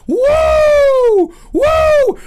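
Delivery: high, loud wrestler whoop; Ric Flair woo. Retold or imitated. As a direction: let rip a pro-wrestling woo. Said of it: "We're doing Ric Flair style".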